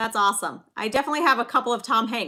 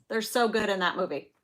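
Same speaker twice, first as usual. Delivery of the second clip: ordinary delivery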